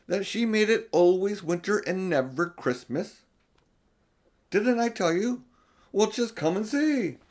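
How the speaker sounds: high and loud, gleeful; triumphant. Acted. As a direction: crow about being proven right. Beaver triumphant over the news.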